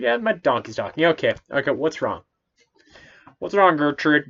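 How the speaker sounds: mock nonchalant Balaam. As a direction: shrug it off like it's nothing. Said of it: "He's like".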